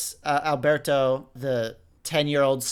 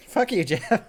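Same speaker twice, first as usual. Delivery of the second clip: laughing jab; 4 semitones higher than usual. Real, not acted. Co-host laughing along at Jeff.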